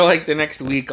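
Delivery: normal talking voice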